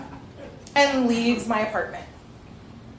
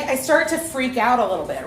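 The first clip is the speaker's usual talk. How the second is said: usual speaking voice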